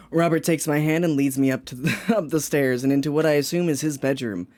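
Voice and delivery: low, soft reading voice; story narration. Acted. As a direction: read it out low and steady.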